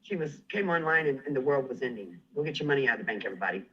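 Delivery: normal talking voice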